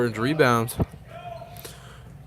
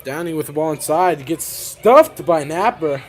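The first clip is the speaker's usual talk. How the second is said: fast, louder and louder up to a big shout; excited block call; 3 semitones higher, 10 dB louder than usual. Real, not acted. Commentator calling a blocked shot to listeners, loud excited call. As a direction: burst out as the shot gets blocked.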